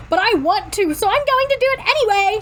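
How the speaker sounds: mock yell, speeding up as it rises; yelling retort. A narrator's reading. Girl arguing her bank analogy, yelling it out. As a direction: pitch climbing high, rushing at the end. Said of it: "she yells".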